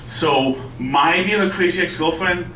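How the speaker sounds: normal talking voice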